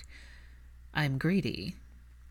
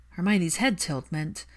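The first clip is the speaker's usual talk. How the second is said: usual speaking voice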